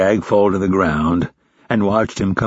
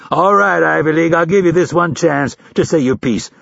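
high, raised drill instructor voice; exasperated instructor; 8 semitones higher, 3 dB louder than usual. A narrator's reading. Instructor lets recruit say his piece, exasperated. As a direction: grant it grudgingly, with an edge. Gruff instructor grudgingly grants a chance.

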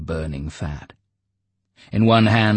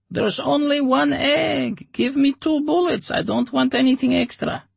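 stubborn Oleg; 4 dB louder than usual. Acted. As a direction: insist on your price. Oleg insists.

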